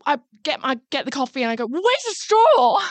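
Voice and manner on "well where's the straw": high, slow demanding whine; mock demanding. Friend mocking her own demand for a straw, slow whine. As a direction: drag it out like a fussy customer. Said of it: "I'm like, "Well, well".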